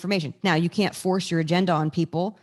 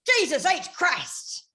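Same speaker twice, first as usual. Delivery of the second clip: high, slow, drawn-out mock exasperation; exasperated southern oath; 8 semitones higher, much slower than usual. Coaching him on a Southern preacher phrase, slow and high. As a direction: stretch it out like a fed-up Texan. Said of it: "when you're upset about it".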